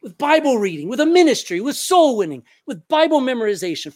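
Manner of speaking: loud, high preaching list. Acted.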